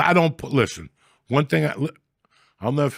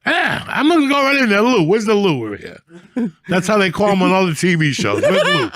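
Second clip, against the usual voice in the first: much higher, loud indignant yelling; mock demand for the lieutenant; 10 semitones higher, 5 dB louder than usual. Hollering at cops for the lieutenant, high furious mock rant. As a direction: call for Lou like you own the place.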